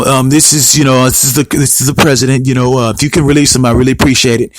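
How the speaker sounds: low, hesitant phone-call imitation; Donald Trump. Imagined president phoning prime minister for a release, low mock-polite request. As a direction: imitate a president haltingly asking a favor, pitched well down. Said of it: "I can imagine Trump giving a call".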